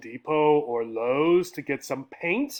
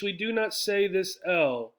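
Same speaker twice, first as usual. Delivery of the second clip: ordinary delivery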